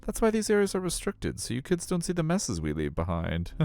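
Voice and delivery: sing-song high staff voice; aquarium staffer. Acted. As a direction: put on a high voice for an official politely shooing you away. Acts out staff member explaining mess.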